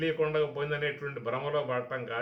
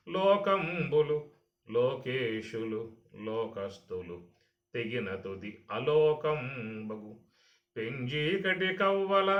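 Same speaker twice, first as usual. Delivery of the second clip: slow, drawn-out verse chant; poem recitation; slower than usual. Shown as a demonstration. Teacher reciting the Bhagavatam verse to listeners, in slow chant. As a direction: chant the Bhagavatam lines slowly like scripture.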